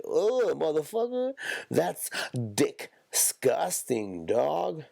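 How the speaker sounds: high grossed-out groan, disgusting stretched slow. Retold or imitated. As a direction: recoil in disgust and drag out disgusting. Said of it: "It's like".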